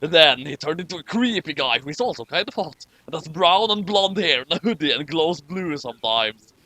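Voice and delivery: ordinary talk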